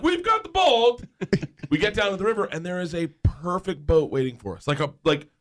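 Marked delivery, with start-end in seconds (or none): 0.0-1.0 high, slow, drawn-out joy; storyteller echoing the buddy, high and slow; delighted cheer. Retold or imitated. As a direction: savour it like a victory. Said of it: "I'm like".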